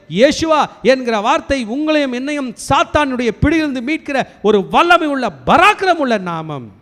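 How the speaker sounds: loud, high-pitched proclamation building on each phrase; full-force preaching. Real, not acted. Preacher proclaiming the name that frees you and me, full-force preaching.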